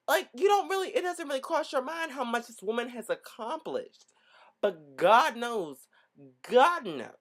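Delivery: normal talking voice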